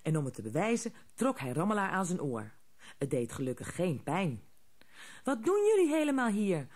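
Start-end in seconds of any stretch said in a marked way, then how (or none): 5.2-6.7 eager question, higher and louder; curious. A narrator's reading. Little girl eagerly asks the gnomes why they're here, curious. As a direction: ask it full of curiosity. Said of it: "vroeg Haasje nieuwsgierig".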